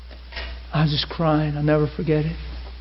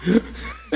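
laughing through tears. Real, not acted. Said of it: "I'm a mess".

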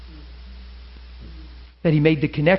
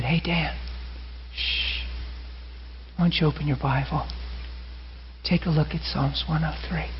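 gentle hush; 8 dB quieter than usual. Retold or imitated. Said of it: "Holy Spirit said".

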